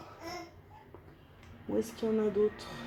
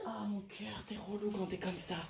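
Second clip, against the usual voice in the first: much softer, low aside; fond complaint under the breath; 3 semitones lower, 15 dB quieter than usual. Real, not acted. Mother to her fussy baby while filming, hushed aside.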